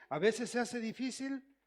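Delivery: ordinary talk, nothing marked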